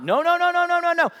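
loud, high repeated no; God firmly refusing a big army; forceful refusal. Acted. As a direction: hammer each no home. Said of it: "God said".

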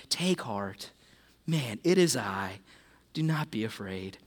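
put-on calm them like a frightened child; Jesus calming the disciples in the storm, tender and quiet; tender reassurance.

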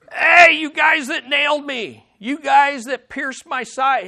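high, loud mock shout at the crowd. Jesus imagined shouting down at his killers, loud mock shout. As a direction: call out loudly from the cross. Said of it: "he's not shouting out to them".

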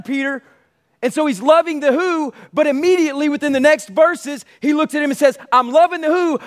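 preaching at full force. Acted. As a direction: preach it out to the whole room. Preaching Jesus's words.

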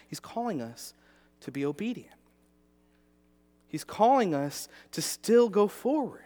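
rising sermon emphasis; preaching. Acted. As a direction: preach the point with conviction. Preaching the point.

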